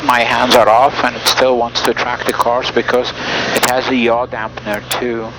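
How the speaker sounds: ordinary delivery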